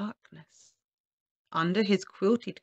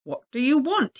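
loud, higher angry demand; angry raised-voice shout; bellowed; 7 semitones higher, 8 dB louder than usual. A narrator's reading. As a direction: bellow down at the visitor. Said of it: "he bellowed".

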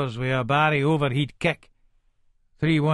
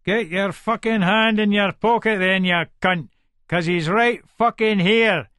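loud, higher angry shout at a mate; imagined shout; 6 semitones higher, 4 dB louder than usual. Retold or imitated. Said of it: "makes us want to shout".

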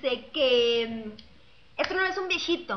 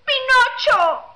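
grito agudo y fuerte, voz cambiada; grito de remate; 9 semitones higher, 8 dB louder, slower than usual. Acted. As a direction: suelta el remate gritando en otra voz. Remate del chiste, viejito grita. (el viejito le grita a su hijo con voz cambiada)

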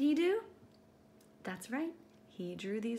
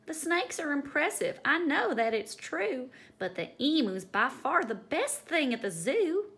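louder, higher visitor voice; zoo visitor; 5 semitones higher, 7 dB louder than usual. Acted. Visitor telling friends the emu is best, loud. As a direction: announce it like an admiring visitor.

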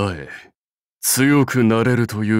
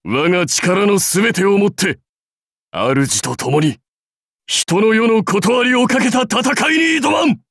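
warlord's rallying cry, rising in pitch to the end; rousing battle vow; 9 semitones higher than usual. Acted. Warlord vowing all his might beside his lord, rousing shout. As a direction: build to a high war shout.

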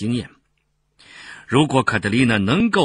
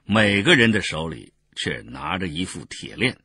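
ordinary delivery

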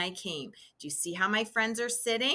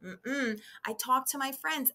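normal talking voice

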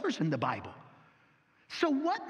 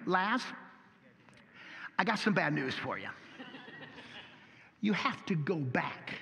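lightly voiced angel, lower and softer; apologetic angel; 5 semitones lower, 4 dB quieter than usual. Angel tapping Lazarus's shoulder, a hint of a low sheepish voice. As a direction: break bad news gently with a stifled laugh. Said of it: "an angel taps him on the shoulder and says".

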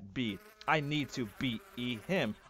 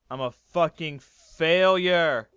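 mock despair; 3 semitones higher, 10 dB louder than usual. Mock self pity as fish.

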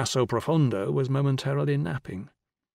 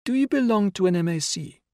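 put-on higher-pitched child's voice; 8 semitones higher than usual. A narrator's reading. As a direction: voice a matter-of-fact little girl. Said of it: "she inquired".

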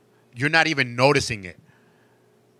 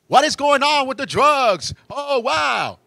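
mock outcry; 11 semitones higher, slower than usual. Mocking public outcry about drugs.

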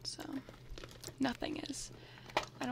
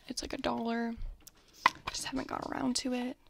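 soft breathy whisper, close to the mic; ASMR whisper; 5 dB quieter than usual. Real, not acted. Vlogger whispering through her bag contents, ASMR style. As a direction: whisper like sharing secrets with a friend.

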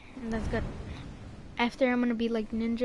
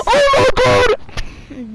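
drawn-out much higher, louder yell of amazement; excited shout; 12 semitones higher, 14 dB louder, much slower than usual. Real, not acted. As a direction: scream it in disbelief at the kill. Amazed at a kill.

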